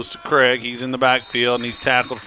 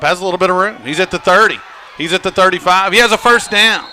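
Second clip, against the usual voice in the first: loud, high, building shout; excited play-by-play; 7 semitones higher, 7 dB louder, faster than usual. Real, not acted. Announcer calls quarterback scrambling for first down, excited shouting. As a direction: call the run with rising excitement. Calling a quarterback's live run.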